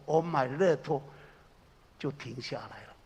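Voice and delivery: ordinary talk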